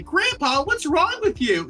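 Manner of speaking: put-on high whiny ten-year-old; squeaky little kid asking. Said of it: "if it's just a ten-year-old saying".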